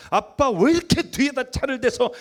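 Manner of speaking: imitating a daughter grumbling at her father; daughter's irritated snap. Pastor imitating his daughter complaining at the car, irritated and whiny. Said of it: "확 짜증을 내면서".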